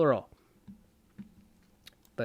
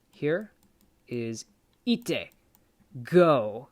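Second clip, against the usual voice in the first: usual speaking voice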